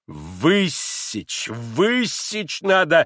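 slow, drawn-out command climbing high; pompous angry demand; outraged. A narrator's reading. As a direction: sputter with indignation, stretching each word. Enraged doctor demands a flogging.

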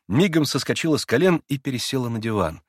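usual speaking voice

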